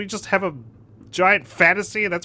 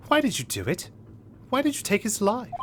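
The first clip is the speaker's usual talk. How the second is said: improvised mystery character; 4 semitones higher, 5 dB quieter than usual. Acted. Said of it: "I don't know what voice to give it".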